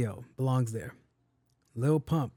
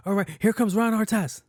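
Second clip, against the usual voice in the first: higher, louder hype-man shout-out; hyped mixtape host; 7 semitones higher, 5 dB louder than usual. Retold or imitated. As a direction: announce him like a mixtape DJ. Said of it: "He'd be like".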